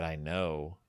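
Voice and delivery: low, soft, chilling reply; quiet villain reveal; cold menacing whisper. Retold or imitated. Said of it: "And I said".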